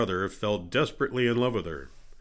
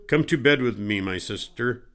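usual speaking voice

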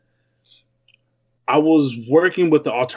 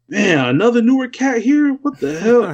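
imitating a shocked buddy, voice way up; surprised exclamation; 9 semitones higher than usual. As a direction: pitch jumps much higher in retold disbelief. Retelling a surprised reaction.

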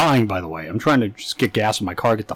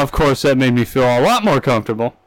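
normal talking voice

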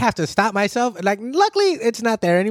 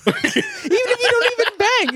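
laughing. Real, not acted. Laughing while telling.

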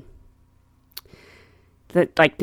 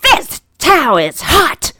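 put-on slow, higher, indignant old-lady complaint; complaining old lady; 6 semitones higher, 3 dB louder, slower than usual. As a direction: mimic a put-out elderly passenger, drawn out. Retelling a passenger's complaint.

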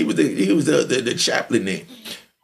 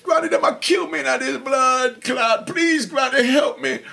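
mock pleading to granny; 7 semitones higher than usual. Beaten man begging his dead granny, high mock-pleading Jamaican voice. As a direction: cry out to a dead grandmother for help, hammed up. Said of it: "You know".

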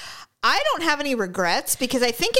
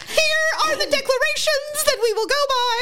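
town crier. Pretend. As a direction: proclaim like a pompous herald. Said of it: "when you said that, I immediately visualized".

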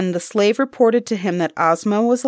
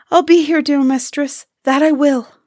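high, loud wailed promise; howling with grief; 7 semitones higher, 5 dB louder than usual. A narrator's reading. Pilka promises the mistress to return, howling with grief. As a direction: wail it out in a raised, grieving voice. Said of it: "howling with grief, made a promise".

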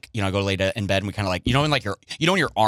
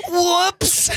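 squeaky whoops. Retold or imitated. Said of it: "I go".

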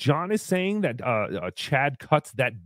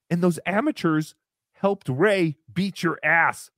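normal talking voice

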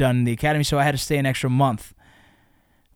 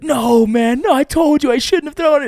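high, louder, frantic no, man; panicked buddy; 10 semitones higher, 4 dB louder than usual. Retold or imitated. Buddy panics after the disc hits the stranger. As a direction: wail that you knew this would happen. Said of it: "my buddy's like".

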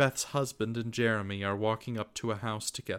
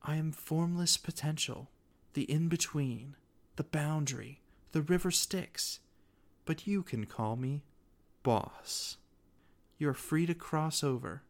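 unearthly voice; 4 semitones higher than usual. Acted. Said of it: "her disembodied voice echoing around me".

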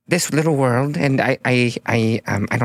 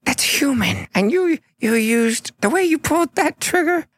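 awed high voice. Acted. Smoggle awed by the shot.

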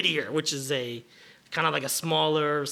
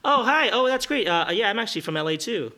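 gushing; 6 semitones higher than usual. Acted. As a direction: gush like a starstruck fan. Improv stranger gushing.

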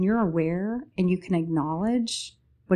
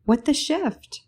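much higher, louder mock surprise; surprised exclamation; 9 semitones higher, 3 dB louder than usual. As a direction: blurt it out in playful shock. Playful exclaimed title phrase.

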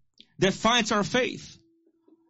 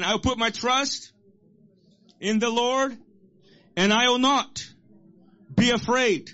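bold declaration; 4 semitones higher than usual. Real, not acted. Congregation repeats verse after him.

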